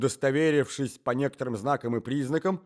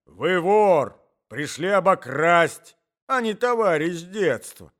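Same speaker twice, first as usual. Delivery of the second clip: put-on loud, raised, indignant old man; accusing shout; 5 semitones higher, 9 dB louder, slower than usual. A narrator's reading. Old husband calling the intruder a thief, accusing shout. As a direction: accuse at full voice. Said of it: "кричал старик".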